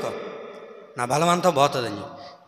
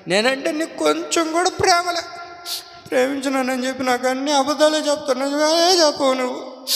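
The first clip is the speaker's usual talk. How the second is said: sulking wife; 10 semitones higher than usual. Acted. Delilah sulks at Samson.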